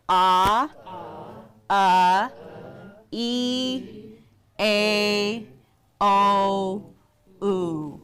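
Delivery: "Ah, ah, uh, e, a, o, oo" as separate held vowels; vowel drill. Shown as a demonstration. Teacher repeating the vowel chart with a class, drill of "Ah, ah, uh, e, a, o, oo". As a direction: sound out each of "Ah, ah, uh, e, a, o, oo" deliberately. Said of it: "let's do this again".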